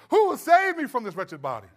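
loud, anguished cry with a laugh at the end; dramatic plea. Retold or imitated. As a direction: ham up the despair of the quote. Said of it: "he's being dramatic".

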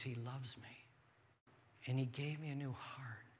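hushed confession. Retold or imitated. Voicing a believer's inner confession.

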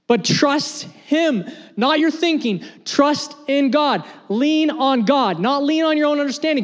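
pitched high, pleading hard; 1 preaching intensity. Real, not acted. Pastor urging the church to trust God, rising passion. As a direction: press the congregation to trust. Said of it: "preaching climax".

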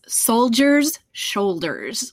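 "soldiers' shoulders" drawn out slowly, word by word; slow, careful articulation. Shown as a demonstration. Narrator demonstrates her hardest phrase, slowly and carefully. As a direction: take "soldiers' shoulders" very slowly to get it right. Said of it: "I have to say it slowly".

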